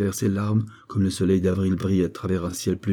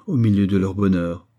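usual speaking voice

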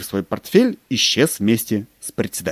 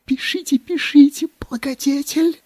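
fawning whisper. Acted. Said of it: "зашептал секретарь".

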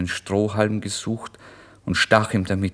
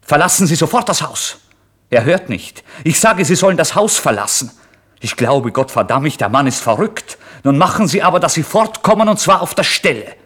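much higher, much louder screeching landlady; enraged landlady throwing the lodger out, shouting; furious landlady; 9 semitones higher, 10 dB louder than usual. Acted. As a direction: order him out at the top of her voice. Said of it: "nun ertönt auch die Stimme der Madame".

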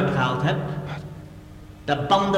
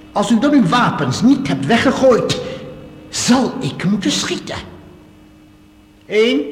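threatening countdown; 4 semitones higher, 4 dB louder than usual. Acted. Counting down before shooting.

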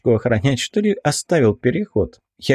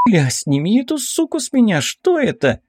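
испуганный возглас; 10 semitones higher than usual. A narrator's reading. Бритва в панике, ласка вцепилась в ухо.

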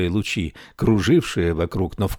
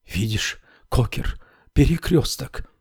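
eager; 5 semitones higher than usual. A narrator's reading. Widow shows ghost husband.

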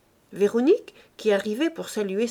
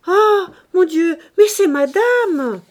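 higher, louder, slow cry of surprise; startled cry; 9 semitones higher, 10 dB louder, slower than usual. Acted. Maid seeing her mistress arrive, crying out in surprise. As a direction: gasp it out as the carriage appears.

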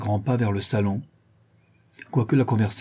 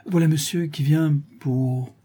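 slow, higher, searching for an excuse; flustered faltering; 6 semitones higher than usual. A narrator's reading. Daughter inventing an excuse for her father, faltering. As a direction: stall and trail off unfinished. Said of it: "balbutia Fedora en rougissant".

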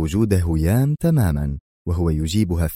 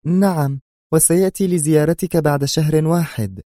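high female character voice; faint-voiced woman; 8 semitones higher than usual. A narrator's reading. She answers his question about Ahmad, in a high female voice. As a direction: answer in a raised, feminine pitch. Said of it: "أجابته في خفوت".

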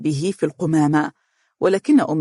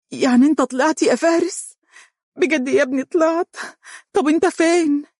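much higher, tearful disbelief; through tears; 11 semitones higher than usual. Acted. Umm Faris hears her son is free, tearful and high. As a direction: ask it through tears of joy. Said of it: "تقول من بين دموعها".

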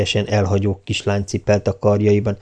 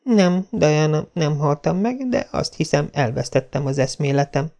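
Anne dazed; 6 semitones higher, 3 dB quieter than usual. A narrator's reading. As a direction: answer weakly, still dizzy. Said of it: "szédelegve ... bizonytalanul válaszolt".

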